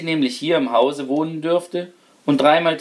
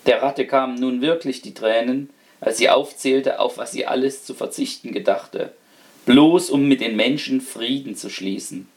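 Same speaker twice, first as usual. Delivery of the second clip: usual speaking voice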